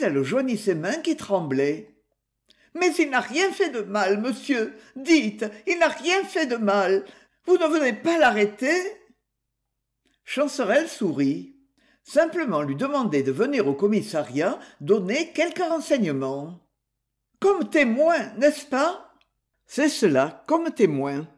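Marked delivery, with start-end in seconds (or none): 2.7-8.7 high-pitched, worried plea; mildly distraught woman begging the inspector; anxious pleading. A narrator's reading. Trembling woman pleads for lover. 17.4-19.1 high, worried question; nervously hopeful, lightly acted; anxious checking. A narrator's reading. Worried woman seeks reassurance.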